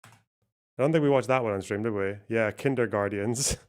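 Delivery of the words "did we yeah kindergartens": normal talking voice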